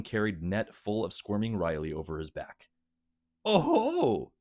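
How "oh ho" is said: high gleeful oh-ho; gloating gnome. Acted. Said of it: "the gnome gloated".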